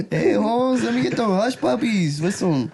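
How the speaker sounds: mock Mexican accent. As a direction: play up the Mexican guy through laughter. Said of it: "Mexican accent".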